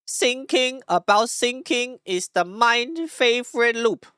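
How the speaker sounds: high, slow, hammed-up Taiwanese accent. Streamer reading shower thoughts for viewers, heavy mock Taiwanese accent. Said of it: "the, the Taiwanese voice".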